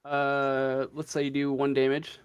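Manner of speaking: ordinary talk, nothing marked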